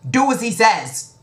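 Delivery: high, loud firm order; commanding king. Acted. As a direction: settle it with a royal command.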